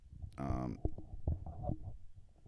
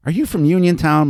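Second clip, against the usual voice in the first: higher, louder question; playing along with a child; 10 semitones higher, 7 dB louder than usual. A narrator's reading. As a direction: ask a little girl playfully. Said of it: "Mowry asked Annie".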